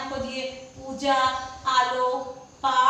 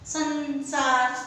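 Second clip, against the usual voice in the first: संसार stretched out slowly; slow model word; much slower than usual. Shown as a demonstration. Teacher to class, slowly modelling संसार. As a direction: draw out संसार clearly. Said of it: "व्यंजन संबंधी भी अशुद्धियां".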